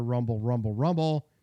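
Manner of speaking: mock engine rumble, spoken; exhaust rumble. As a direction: rumble it like a dragging tailpipe. Imitating the broken exhaust.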